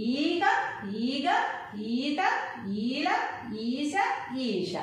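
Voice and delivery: stretched ఈ opening ఈశ and ఈష; long vowels. Shown as a demonstration. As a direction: hold the first ఈ long in ఈగ, ఈత, ఈల. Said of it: "లాంగ్గా పలికాం వీటిని".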